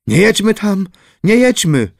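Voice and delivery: high, frightened plea; fearful pleading. A narrator's reading. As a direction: beg them anxiously not to go on. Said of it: "poczęła wołać bojaźliwa Anula Sieciechówna".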